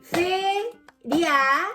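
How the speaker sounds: feria broken into fe-ria; split into syllables. Shown as a demonstration. Teacher to pupils, splitting the word again. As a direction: say feria in two separate syllables. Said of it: "La separamos en sílabas nuevamente".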